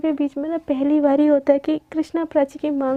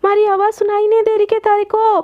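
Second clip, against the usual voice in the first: mother's raised, higher-pitched summons; calling out; 5 semitones higher than usual. Acted. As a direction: call a dawdling child from another room. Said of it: "माँ सा फिर से आवाज़ देती". (ऊँची आवाज़ में पुकारते हुए)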